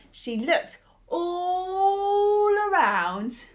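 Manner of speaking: high, slow, drawn-out look around; suspenseful slow. A narrator's reading. Narrator building suspense in the forest, slow and high. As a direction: stretch it out for suspense.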